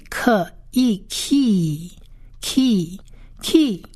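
"可以，key" very slowly blended into kheee; kh, ee, then drawn-out khee for "可以，key"; long vowel demo. Shown as a demonstration. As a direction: split "可以", then hold the ee in "key". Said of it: "是发一个长义的音".

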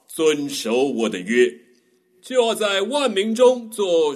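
slow, majestic booming delivery; God's voice. Acted. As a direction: thunder it out like God on the mountain. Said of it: "请夏凡哥为我们揣摩一下上帝对摩西说的话". (气势磅礴的上帝声音)

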